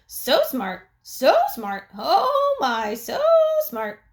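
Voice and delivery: high bird voice; bird mimicking. A narrator's reading. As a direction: squawk it back. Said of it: "Uncle Feather said".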